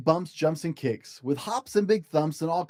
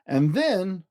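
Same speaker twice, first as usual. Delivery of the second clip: plain reading voice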